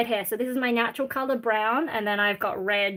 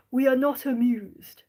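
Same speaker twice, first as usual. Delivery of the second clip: mock English accent; slower than usual. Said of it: "I don't even know how to do an English accent".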